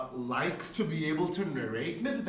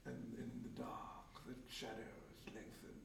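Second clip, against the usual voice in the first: breathy dramatic whisper, much softer; cool narrator voice; 5 semitones lower, 20 dB quieter than usual. Retold or imitated. GM monologuing at session start, hushed ominous whisper. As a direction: hush the table with ominous scene-setting. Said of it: "like, a cool voice".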